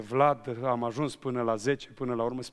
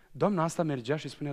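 usual speaking voice

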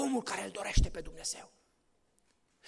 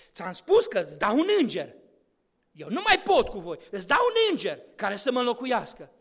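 louder stern God refusing to go; firm divine voice; 2 semitones higher, 8 dB louder than usual. Acted. God tells Moses he will not come, in a louder stern voice. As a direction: declare it as an offended God.

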